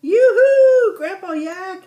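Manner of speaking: high, loud, excited yoo-hoo; excited yell. A narrator's reading. Yalta Yak calling excitedly to family at the door, loud high yell. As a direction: call out with joy at arriving home. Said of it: "yelled Yalta Yak excitedly".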